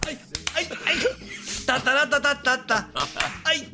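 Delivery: high, soft yelps and rhythmic ta-ta-ta; playful vocal percussion; mock flamenco cries. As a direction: imitate off-beat palmas with little high cries. Mimics off-beat clapping and cries.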